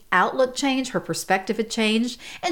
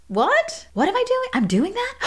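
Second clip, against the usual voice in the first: a mother caught off guard, asking in high alarm; shocked disbelief; 13 semitones higher than usual. Retold or imitated. Mom hearing she's been negative, shocked and high-pitched. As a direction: voice jumps way up in startled surprise.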